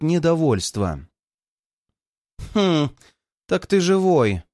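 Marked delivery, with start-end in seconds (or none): 2.5-4.4 капризный голос избалованной девицы; протяни капризно, чуть выше. A narrator's reading. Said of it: "капризным голосом произносит". (капризный надутый тон)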